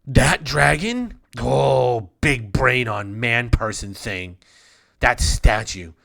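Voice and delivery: put-on loud, childlike queen voice, proud of her dragon; simple dwarf queen. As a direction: boast like a dim little queen, loud.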